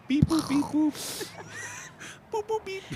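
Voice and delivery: high beep-boop robot chirps; squeaky toy robot beeping. Pretend. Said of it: "devolving to robot sounds".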